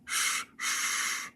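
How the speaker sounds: soft unvoiced steam hiss. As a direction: hiss out like an engine. Steam sounds.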